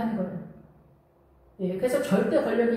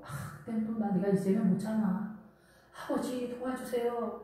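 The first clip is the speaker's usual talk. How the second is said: pleading prayer; 6 dB quieter than usual. Retold or imitated. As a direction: beg the Father quietly. Wife's tearful prayer voiced.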